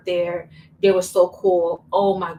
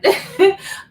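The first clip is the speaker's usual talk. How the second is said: high giggling laugh; burst of laughter; 12 semitones higher, 5 dB louder than usual. Real, not acted. Laughing at her own remark.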